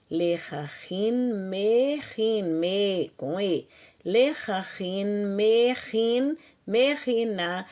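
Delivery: "lechahin" e "mechin" ditos devagar, sílaba por sílaba; slow drill. Shown as a demonstration. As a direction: recite a conjugação bem lenta e clara. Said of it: "drill paradigm". (professora repete as formas devagar para a turma)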